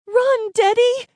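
high, loud, drawn-out shout; urgent yell. A narrator's reading. Geeta warning Daddy as bullets fly, urgent yell. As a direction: scream it as a desperate warning. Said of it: "Geeta yelled".

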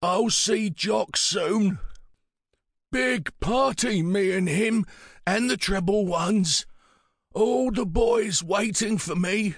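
put-on high, slow, halting old man; frail old man. A narrator's reading. Pritch looking forward to joining dead friends, slow frail old voice. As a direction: draw the words out as a wistful elder.

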